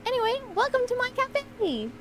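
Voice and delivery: high bright welcoming host; cheerful shopkeeper. Acted. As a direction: greet them like a chirpy cafe owner. Cafe owner greeting customers.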